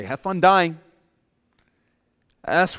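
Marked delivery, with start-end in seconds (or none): 0.0-0.8 loud, curt parting shot; outraged retort. Pretend. Outraged father grabbing his son, loud and curt. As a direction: snap it back bitterly. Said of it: "I'd grab my son and I'd say".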